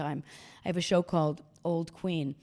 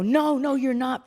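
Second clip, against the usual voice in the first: mock crowd protest; 7 semitones higher, 7 dB louder than usual. Crowd protests her claim.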